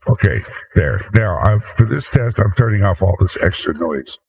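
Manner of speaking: heavily compressed, echoey mic sound; over-compressed mic. Real, not acted. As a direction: voice through a stacked compressor chain with echo. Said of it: "God, it's echoes".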